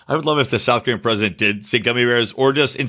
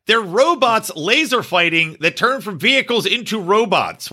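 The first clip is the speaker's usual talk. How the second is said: higher, excited rant; excited disbelief; 7 semitones higher than usual. Real, not acted. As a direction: push it up in disbelief. Outraged defense of cartoon.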